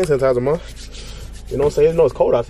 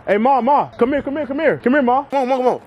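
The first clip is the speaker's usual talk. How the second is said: raised, coaxing call across the ice; urgent higher call to mom; 10 semitones higher than usual. Real, not acted. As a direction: call her over, eager and high. Calling mom to come on ice.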